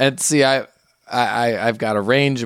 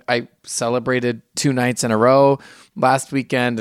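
ordinary delivery

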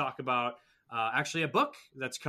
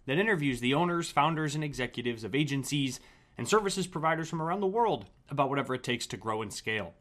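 punchy, projected host announcement with lively pitch swings; polished scripted episode introduction; podcast intro read; 3 semitones higher, 4 dB louder than usual. Acted. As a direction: read the show intro crisply and emphatically. Host reads scripted show introduction.

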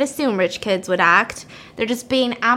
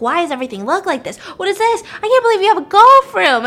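put-on awestruck kids; 7 semitones higher, 5 dB louder, faster than usual. Awed rich-house kids retold.

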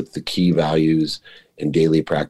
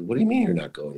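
shocked disbelief; 10 semitones higher, faster than usual. Retold or imitated. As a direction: pitch jumps way up in disbelief. Retold shocked reply to friend.

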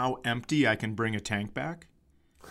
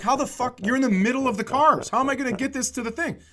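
much higher, fast exasperated rant in a put-on voice; pissed-off attendant; 11 semitones higher, 3 dB louder, faster than usual. Rental guy fumes at the drivers who left a dead car, exasperated. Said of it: "This guy's pissed".

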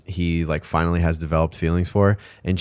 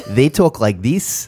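slow, higher-pitched mock New Zealand accent; 4 semitones higher, slower than usual. Shown as a demonstration. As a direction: demonstrate a high, drawn-out Kiwi accent. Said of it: "New Zealand".

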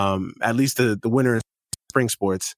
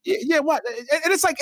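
usual speaking voice

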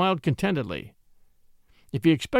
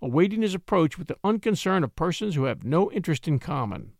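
usual speaking voice

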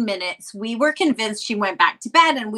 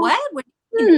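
surprised; 11 semitones higher, 6 dB louder, much faster than usual. Retold or imitated. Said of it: "And I was like".

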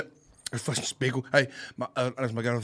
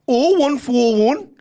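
loud, slow, raised Geordie digits; attempted Geordie accent; 10 semitones higher, 10 dB louder, slower than usual. Shown as a demonstration. Guest reads the taxi number on request, attempted Geordie accent. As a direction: call the number out in bad Geordie. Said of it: "What's the number in a Geordie accent?".

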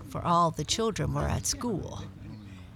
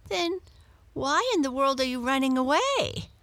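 higher, baffled question; puzzled cat; 8 semitones higher, 3 dB louder than usual. A narrator's reading. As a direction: Muffins can't see the point of leaving. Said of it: "Muffins wanted to know".

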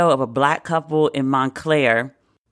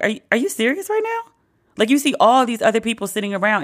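high, squeaky are you serious; high-pitched mock disbelief; incredulous disbelief; 5 semitones higher, faster than usual. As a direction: play her unable to believe it. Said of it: "The woman was like".

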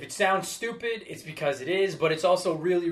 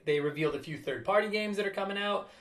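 normal talking voice

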